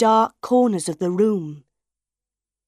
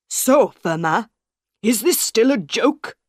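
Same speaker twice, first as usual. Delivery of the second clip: louder, exasperated director; angry, half-shouted challenge; a narrated shout, louder; 3 semitones higher, 4 dB louder than usual. A narrator's reading. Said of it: "Monsieur Armand shouted".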